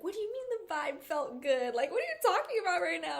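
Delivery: high, quickening disbelief; incredulous. Retold or imitated. Retold friend questioning the good vibe, incredulous. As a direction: question it as a baffled friend would.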